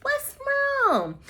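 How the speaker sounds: sugary mock concern, very high; high sweet Andy. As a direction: coo at her like a baby. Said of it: "And Andy's like".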